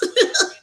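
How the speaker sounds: loud high laugh; burst of laughter. Real, not acted. Laughing at own joke.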